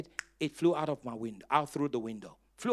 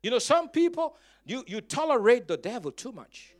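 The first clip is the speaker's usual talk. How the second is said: preaching at full force; 7 semitones higher, 6 dB louder than usual. Acted. Preacher exhorting congregation.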